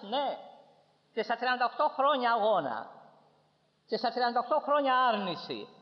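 high, driving sermon voice; impassioned preaching. Acted. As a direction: preach it high and insistent, hammering each repeated phrase. Preaches at full force.

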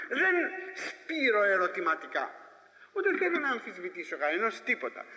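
normal talking voice

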